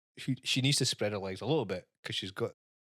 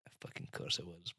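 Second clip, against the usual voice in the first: breathy whispered sarcasm; under the breath, sarcastic; muttered sarcasm; 4 semitones lower, 12 dB quieter than usual. Retold or imitated. Thinking about the pink bag, sarcastic aside.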